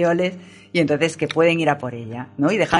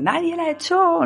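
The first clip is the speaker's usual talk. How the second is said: high, drawn-out shouted denial; mock shout; 12 semitones higher, slower than usual. [name] at the door, slow high shout.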